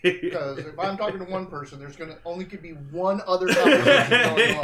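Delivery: laughing out loud while talking; words pushed through laughter. Real, not acted.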